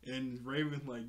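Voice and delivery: ordinary delivery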